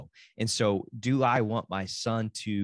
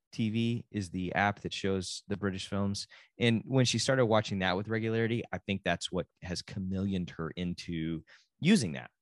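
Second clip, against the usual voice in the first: ordinary talk, nothing marked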